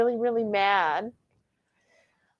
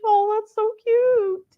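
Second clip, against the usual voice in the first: cooing at the dogs; 12 semitones higher, 3 dB louder, slower than usual. Retold or imitated. Cooing at dogs sharing bed.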